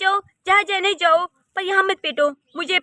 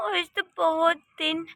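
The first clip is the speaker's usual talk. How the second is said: ordinary talk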